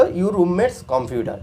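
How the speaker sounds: very slow, word by word; slow teacher's example; slow demo reading. Shown as a demonstration. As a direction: model the phrase slowly for the class. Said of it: "ইংলিশে কী হলো?".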